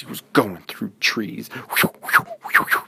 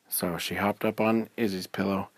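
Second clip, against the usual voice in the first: ordinary delivery, nothing marked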